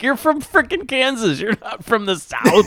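retort to a drawler. Retold or imitated. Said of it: "the slow Southern drawl of the people".

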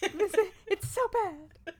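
high, loud, giggly glee; laughing mock delight. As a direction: squeal it with laughter. Gleeful mock reaction to bad image.

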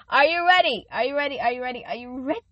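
high, loud rallying call; host counting in the celebration chant, pumped; hype count-in. Real, not acted. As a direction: rev up the crowd before the song.

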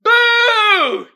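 high, loud booing shout; mock yell. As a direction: boo like a fed-up audience member. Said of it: "crab dude yelling".

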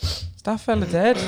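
high, slow, tentative asking; high-pitched imitation of a curious onlooker; retold nosy question. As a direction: ask it slowly, pitched right up.

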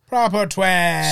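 slow, hammed-up Irish drawl of "Proper twelve"; mock Irish accent. Friend echoes the catchphrase in a hammed-up Irish accent. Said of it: "mock Irish accent on catchphrase".

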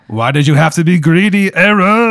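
hammed-up French accent, rising loud on the last word; bad French accent. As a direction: sound like an American faking a French accent. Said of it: "in a really weird French accent".

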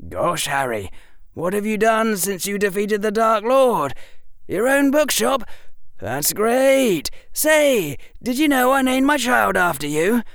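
breathless fawning admirer; gushing fan. Retold or imitated. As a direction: gush like a starstruck fan. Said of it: "Harry mimics fawning fans".

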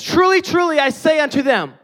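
high, loud preacher's proclamation; full-force preaching. Acted. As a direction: thunder the words of Jesus at the congregation. Preaching Jesus' words at full force.

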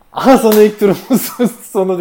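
high, loud laughing taunt; laughing gloat. Retold or imitated.

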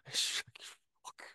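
ordinary talk, nothing marked

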